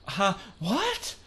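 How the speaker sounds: high, squeaky shocked cry of disbelief; a sudden jump way up in pitch, gasping what happened to your mouth; shocked squeal. Retold or imitated. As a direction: squeal it like a startled kid seeing something impossible. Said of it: "as opposed to Nell going".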